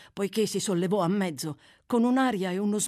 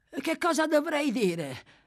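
slow, higher-pitched drowsy question; sleepy; 8 semitones higher, slower than usual. A narrator's reading. Sleepy Noah asks what he should say, drowsy. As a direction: drag it out as if half asleep. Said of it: "domandò l'assonnato Noah".

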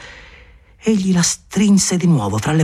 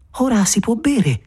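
Maria, high and slow; 8 semitones higher than usual. Acted.

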